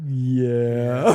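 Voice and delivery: low, drawn-out mock yeah; imitated sheepish low groan of assent; reluctant admission. Suspect admits pills are Viagra.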